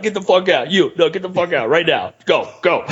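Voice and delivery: retold angry dismissal. Masked singer to audience member, mock angry ejection. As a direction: imitate ordering someone out.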